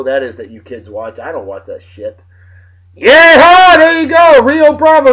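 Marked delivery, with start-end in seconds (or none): 2.9-5.1 excited cheer. Real, not acted. Excited cheer at movie clip.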